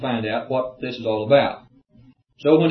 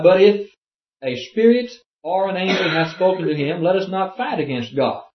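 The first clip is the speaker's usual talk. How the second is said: higher, proclaiming Bible voice; raised formal reading voice; scripture reading; 6 semitones higher, 4 dB louder than usual. Acted. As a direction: declaim the verse with lifted pitch. Reads scripture aloud.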